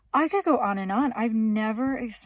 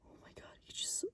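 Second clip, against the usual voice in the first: alarmed whisper; 8 dB quieter than usual. Real, not acted. Camper secretly watching the man next to her, alarmed whisper. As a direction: whisper to viewers without being overheard.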